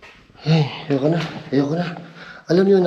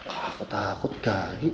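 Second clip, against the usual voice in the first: scared hushed voice; 7 semitones lower, 11 dB quieter than usual. Real, not acted. Scared alone deep in cave.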